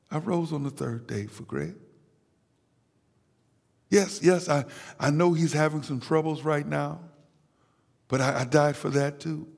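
Jesus pleading softly to the Father, voice dropped low; hushed pleading. Acted. Jesus speaks to the Father, soft quiet pleading. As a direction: speak it gently, almost under your breath, as Christ interceding.

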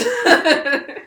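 laughing it out. Real, not acted. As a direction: burst into laughter on it. Laughs on the word.

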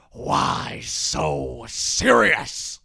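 loud, very slow rasping villain; hammed-up menacing movie villain; Joker impression. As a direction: stretch each word into a hoarse growl. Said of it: "Playing the Joker".

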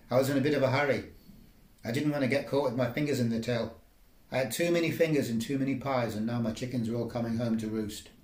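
ordinary delivery

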